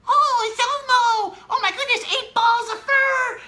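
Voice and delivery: very high, squeaky puppet voice, slow and bright; silly Elmo voice. Shown as a demonstration. Parent reading to William as Elmo, very high silly voice. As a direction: play Elmo for a toddler, way up high. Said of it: "something like a silly voice".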